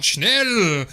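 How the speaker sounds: loud, high mock German shout; German accent. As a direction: call it out in a hammed-up German accent. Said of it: "German accent, much better".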